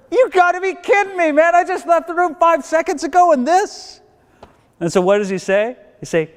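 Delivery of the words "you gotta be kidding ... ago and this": high-pitched acted indignation; hammed-up royal outrage; outraged disbelief. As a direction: play the incredulous furious king, voice pitched way up. Enraged king walks in, acted.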